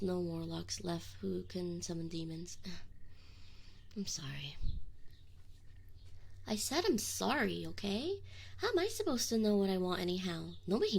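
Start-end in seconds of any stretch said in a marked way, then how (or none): 2.6-4.6 soft, voiceless "I'm sorry"; whispered apology. Acted. Demon apologizing to summoner in a whisper. 6.5-8.2 firmer, full-voiced "I said I'm sorry"; defensive apology. Demon repeating his apology to summoner, firmer.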